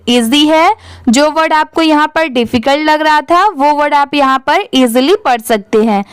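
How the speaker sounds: ordinary talk, nothing marked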